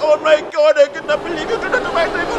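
high, loud whiny outrage; mock outrage. Djibouti people crying over their table, mock outrage. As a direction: squeal like the table's owner. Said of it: "Djibouti and I were like".

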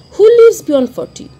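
sudden high, loud cry of scorn; indignant outburst. Acted. Narrator challenges the gentlemen with a loud, scornful cry. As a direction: fling the question at them in outrage.

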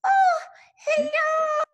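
loud tearful puppet cry; scared and upset. Acted. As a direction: shout out in relief and fright. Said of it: "scared and upset".

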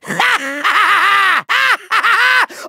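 long, gleeful villain laugh; evil cackle. Acted. Villain about to fire cannons, gleeful cackle. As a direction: cackle with menace.

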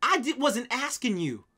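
loud, high, drawn-out telling-off; acted indignant shouting; exasperated outburst. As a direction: snap back at a killjoy, stretching each word. Acted retort to online killjoys.

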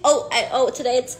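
ordinary delivery

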